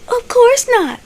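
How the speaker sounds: mock flustered, high-pitched denial. Geneva denying to Javon that the baby is his, high and flustered. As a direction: squeak out a rattled denial, imitating her. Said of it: "just a stuttering away".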